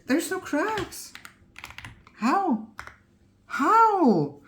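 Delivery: high, slow amazed squeal; can't believe it; stunned disbelief. Real, not acted. Disbelief at no cracks.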